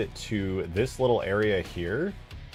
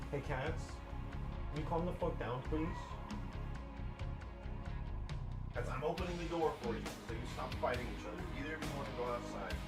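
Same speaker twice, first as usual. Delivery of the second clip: distant, soft, higher-pitched talk away from the mic; off-mic aside to pets; 5 semitones higher, 13 dB quieter, faster than usual. Real, not acted. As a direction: call to the cats from across the room. Steps away to talk to cats.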